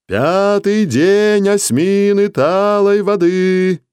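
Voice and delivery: high, slow, loud town crier call; ringing call. Acted. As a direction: call it out across the village. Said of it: "зычно выкрикивал Кругосчёт".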